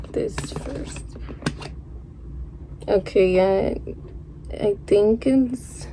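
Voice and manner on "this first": soft whispered aside; whispering. Real, not acted. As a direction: breathe the words out. Aside while opening.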